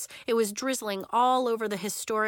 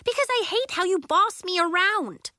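high, loud angry outburst; furious, high-pitched retort; exploding; 10 semitones higher, 5 dB louder than usual. A narrator's reading. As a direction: explode at a bossy partner. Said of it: "Willa exploded".

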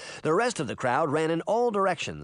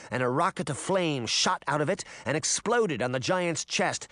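ordinary delivery

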